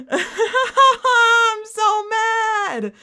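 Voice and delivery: very high, drawn-out laugh through the words; laugh-wail at your own bad luck; stretched squeal of laughing frustration; laughing mad. Real, not acted.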